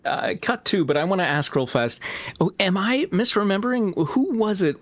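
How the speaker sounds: ordinary talk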